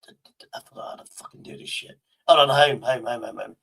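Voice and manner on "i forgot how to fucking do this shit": muttered under the breath; frustrated whisper at the screen. Real, not acted. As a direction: whisper it to yourself, annoyed. Muttering to self.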